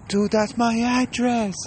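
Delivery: high, drawn-out mock panic; panicked victim. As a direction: wail it slowly in comic alarm. Victim hears his address.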